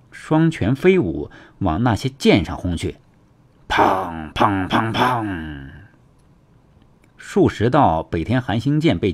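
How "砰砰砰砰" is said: slow series of ringing vocal bangs; imitated impact booms. A narrator's reading. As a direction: sound out four blows, the last ringing on. Narrator imitates repeated blows.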